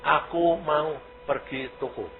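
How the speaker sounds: imitating slowed, separated speech; halting impression of a recovering speaker; slow, word by word. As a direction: space out every word slowly. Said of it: "Jadi pelan-pelan, satu-satu".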